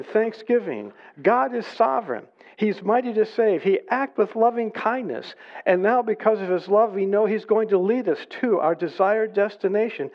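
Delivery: high, driving praise from the pulpit; fervent preaching. Real, not acted. Preacher extols God's loving kindness to the congregation, fervent. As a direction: preach it with rising fervor.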